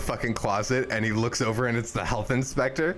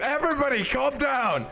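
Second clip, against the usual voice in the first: high, raised call for calm; shouting over the table; 12 semitones higher than usual. Real, not acted. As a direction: yell over the noise to settle everyone.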